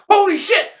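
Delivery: high, loud exclamation; loud startled outburst. Acted. Leonard in the firing line, loud startled curse. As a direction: blurt it out loud. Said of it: "said out loud".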